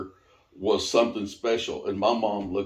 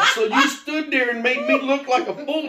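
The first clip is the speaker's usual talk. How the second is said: high, loud mock outrage through a laugh; laughing disbelief; 6 dB louder than usual. [name] confronts Patty, high-pitched laughing indignation. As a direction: squeal it out, half laughing. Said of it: "I'm like".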